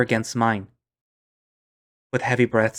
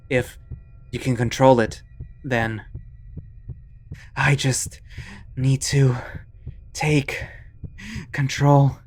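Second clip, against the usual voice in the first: strained; 3 semitones higher, slower than usual. Acted. Panicked self-talk.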